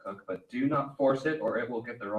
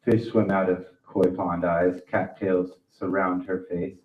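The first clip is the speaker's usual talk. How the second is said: loud, intense spoken word; poetry reading; 15 dB louder than usual. Acted. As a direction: declaim the poem loudly. Performs his poem.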